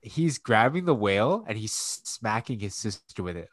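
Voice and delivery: usual speaking voice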